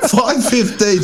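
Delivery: laughing through the numbers in disbelief. Real, not acted. Teammates retelling a time trial, laughing at the target.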